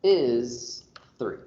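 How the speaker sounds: slow read-aloud with long pauses between phrases; reading notation aloud. Shown as a demonstration. Teacher turning math symbols into a sentence for the class, slow read-aloud. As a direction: read it out piece by piece, very slowly. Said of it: "I read this out loud as".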